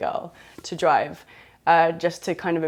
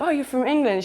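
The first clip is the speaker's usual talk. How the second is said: mock surprise; 7 semitones higher than usual. Feigned surprise at her accent.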